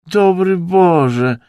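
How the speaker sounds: high, loud, drawn-out wail; theatrical high lament. A narrator's reading. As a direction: howl it out in despair, slowly. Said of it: "zawył".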